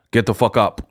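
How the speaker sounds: cool masculine. Shown as a demonstration. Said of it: "that's how I would say".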